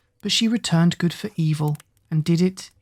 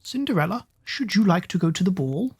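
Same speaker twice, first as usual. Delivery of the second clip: teasing eldest sister; 2 semitones higher than usual. A narrator's reading. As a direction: tease her with a lifted voice. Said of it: "the eldest said".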